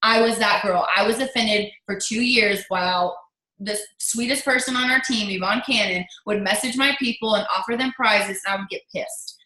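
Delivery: ordinary delivery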